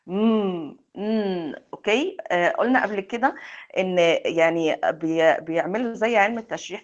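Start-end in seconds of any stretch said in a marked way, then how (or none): 0.1-0.8 a humming mm through the nose; nasal hum. Shown as a demonstration. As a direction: hum the m sound with closed lips. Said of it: "بنستخدم our nose when we pronounce it".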